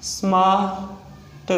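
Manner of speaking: Smart split into "smaa", pause, "t"; sounded out slowly. Shown as a demonstration. Teacher breaking down a shorthand example, sounded out slowly. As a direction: stretch smart, detaching the final t.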